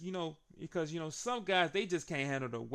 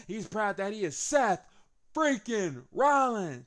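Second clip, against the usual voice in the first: hyped boast; 7 semitones higher, 8 dB louder, slower than usual. Retold or imitated. Hyping Seth's boast.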